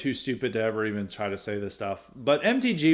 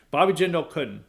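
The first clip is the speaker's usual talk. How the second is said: normal talking voice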